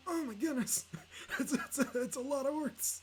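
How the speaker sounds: high laughing oh-my-goodness; overwhelmed. Retold or imitated. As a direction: gush in giddy disbelief. Retold overwhelmed reaction, laughing.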